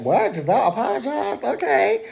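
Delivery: high, quick imitated astonishment; mock surprise. Voices Wendy's surprise at the apology.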